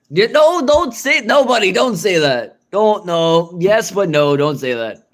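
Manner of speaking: high, loud frantic no; a frantic high yelp of don't say that; panicked protest. Real, not acted.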